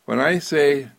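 usual speaking voice

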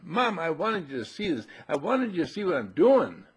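a hint of an eager child; little boy explaining. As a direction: plead to Mom like a small boy. Voicing boy explaining to mother.

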